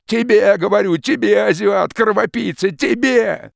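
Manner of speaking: high, loud choking rage; furious outburst. Acted. Peasant hurling words at the forester, furious shout. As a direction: fling each word at him like a blow.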